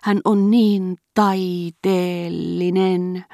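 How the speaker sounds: high, loud, drawn-out gushing; enraptured. A narrator's reading. Old marquise praising her daughter-in-law, in slow rapture. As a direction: savour every word in ecstasy, stretching it right out. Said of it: "mutusteli markiisitar vielä kerran hurmioissaan".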